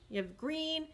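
ordinary delivery, nothing marked

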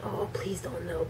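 hushed plea. Retold or imitated. Girl praying dad won't find out, hushed. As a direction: plead under your breath. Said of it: "She's thinking".

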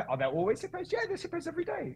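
high sing-song Welsh impression; Welsh accent. Race director questions the driver about hippos, high Welsh accent. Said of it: "I went into Welsh accent".

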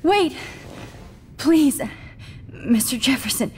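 desperate plea. Acted. Captive begs killer to stop.

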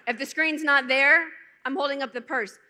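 ordinary delivery

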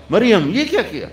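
high, scandalised cry at Maryam; shocked accusation. Retold or imitated. Townsfolk accusing Maryam, shocked and high. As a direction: gasp it out as an outraged crowd. Crowd taunting Maryam in sermon.

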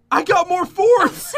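high, loud, drawn-out shout; excited shout. Real, not acted. Player makes a lucky find, excited shout.

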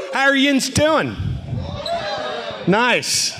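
high, put-on Pittsburgh accent. Visiting performer greeting Pittsburgh fans in their local Pittsburgh accent. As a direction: greet them in a broad Pittsburgh accent, pitched well up. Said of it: "I love, I love the accent".

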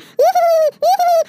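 two high-pitched spooky giggles; short, very high ghost cackles. Acted.

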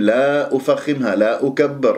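ordinary talk, nothing marked

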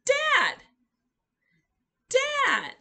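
Dad called out high and loud; excited demo word. Shown as a demonstration. Teacher cheering toddlers through the sign, loud high Dad. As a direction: belt out Dad with delight. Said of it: "And we go".

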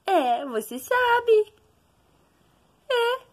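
very high, slow sing-song praise. Real, not acted. Mother praising baby.

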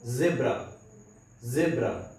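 ज़ेब्रा with a buzzing z, not j; z in zebra. Shown as a demonstration. Teacher modelling ज़ेब्रा for interview prep, buzzing z. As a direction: start ज़ेब्रा on a voiced z. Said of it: "इस तरह से pronounce करेंगे".

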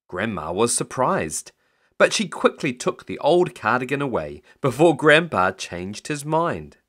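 ordinary delivery, nothing marked

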